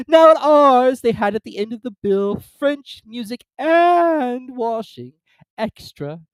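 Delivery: high, loud weepy voice; weepy Mock Turtle. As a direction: wail like a sad creature.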